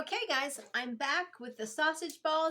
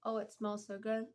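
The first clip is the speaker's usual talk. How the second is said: usual speaking voice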